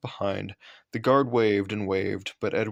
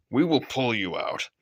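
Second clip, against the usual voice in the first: firm controller; 2 semitones higher than usual. A narrator's reading. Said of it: "he said".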